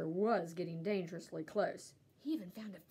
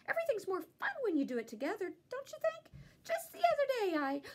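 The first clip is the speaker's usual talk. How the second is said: high, fast rambling chatter; chatty dog; 9 semitones higher than usual. A narrator's reading. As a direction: babble on without a breath. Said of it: "would have gone on like that for hours".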